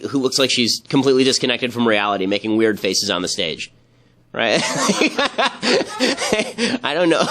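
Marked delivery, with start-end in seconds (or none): 4.6-6.8 high burst of laughter. Real, not acted. Mocking Winona Ryder comparison.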